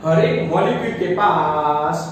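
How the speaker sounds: slow and deliberate, lingering on the last word; slow emphatic statement. Real, not acted. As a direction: stretch the key phrase out word by word. Teacher restates key line slowly.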